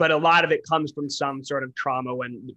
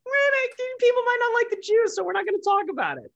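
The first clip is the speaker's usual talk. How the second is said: high, sneering mock voice of the media. Mocking the media's excuse, high and sneering. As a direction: pitch it way up like a dismissive newsroom. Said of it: "they're like".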